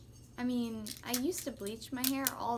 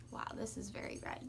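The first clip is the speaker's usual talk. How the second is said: hushed awe; whispered; 2 semitones lower, 7 dB quieter, faster than usual. Real, not acted. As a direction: breathe it out in surprise. Whispers at the red dye.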